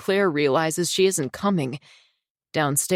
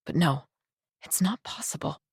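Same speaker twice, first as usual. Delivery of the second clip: much softer, hushed denial; quiet denial; 5 dB quieter than usual. Acted. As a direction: breathe it out softly to yourself. Inner denial, said softly.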